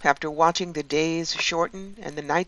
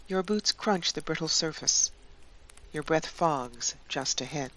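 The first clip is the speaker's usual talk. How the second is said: guided meditation; 7 dB quieter than usual. Acted. Guide leading listeners into a Yule winter journey, guided meditation. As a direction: guide them gently, leaving long spaces.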